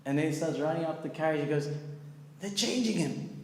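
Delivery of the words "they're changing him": high-pitched, thrilled cry breaking into laughter; giddy mock astonishment; imitated ecstatic amazement. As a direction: mimic an astonished onlooker spreading the news. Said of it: "he came ecstatic".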